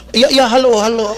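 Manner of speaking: laughing, hammed-up forced politeness; fake friendly greeting. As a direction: greet an enemy with a put-on laugh. Mock fake friendly church greeting.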